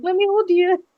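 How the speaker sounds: put-on high, cooing plea to cuddle a toddler; doting mom. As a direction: coax the child into a hug. Mother pleads with daughter.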